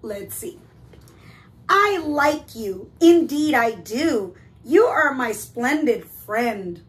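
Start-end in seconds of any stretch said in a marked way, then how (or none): 1.7-6.8 loud, deliberate goose declaration; reading a note aloud. Acted. Goose reading kind words to the bear, declaiming. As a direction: proclaim each phrase of the friendship note. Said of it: "what his note had to say".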